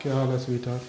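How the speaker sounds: low, hushed endearment; soft sweet-talk. Acted. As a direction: greet a sweetheart quietly and low. Later, sweet-talking after the quarrel. (धीमी आवाज़ में प्यार से)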